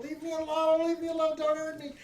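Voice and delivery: put-on a scared child begging to be left alone; crying little girl. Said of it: "she's just crying. She's just wailing".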